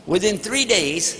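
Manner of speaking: ordinary talk, nothing marked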